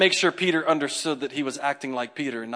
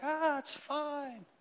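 higher, slow, soft and unbothered; imitating easy-going pastors; 11 semitones higher, 11 dB quieter, much slower than usual. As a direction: play it cool like nothing's wrong. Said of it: "And they were like".

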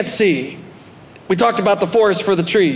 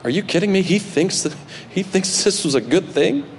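softer, hushed disbelief between brothers; 2 semitones higher, 5 dB quieter than usual. Retold or imitated. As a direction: whisper it to each other in shock. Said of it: "looking at each other going".

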